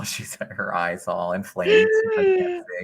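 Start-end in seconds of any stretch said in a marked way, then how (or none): 2.2-2.8 very high mock-crying child; wailing little girl. Imagined girl with pink eye, mock wail. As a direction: whine it high as a pretend crying kid.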